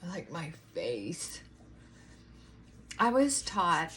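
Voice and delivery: ordinary talk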